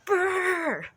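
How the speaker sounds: loud high shivering brrr; shivering brr. Real, not acted. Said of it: "Oh, I'm freezing".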